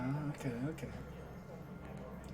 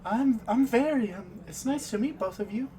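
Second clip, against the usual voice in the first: nervous, wavering and higher; a shy trembling greeting; shaky voice; 7 semitones higher than usual. Acted. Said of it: "it says with a almost shaky voice".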